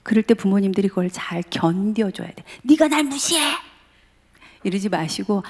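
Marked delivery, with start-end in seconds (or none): 2.6-3.4 offended parent. Retold or imitated. Parent snapping at teen.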